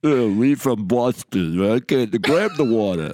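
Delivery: slow, heavy mock Boston accent; hammed-up Boston accent. Mocking a friend in a slow, hammy Boston accent. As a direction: ham up a thick Boston drawl like Rocky. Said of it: "that's your average Boston accent".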